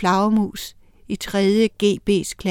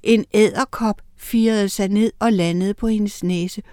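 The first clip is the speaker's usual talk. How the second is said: plain reading voice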